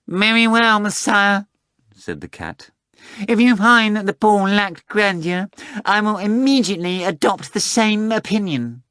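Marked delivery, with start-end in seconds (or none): 0.1-1.4 high, slow, haughty cat voice; a prim talking cat, taking offence; Behemoth the cat voice. Acted. 3.3-8.8 a prim talking cat, taking offence; Behemoth the cat voice. Acted.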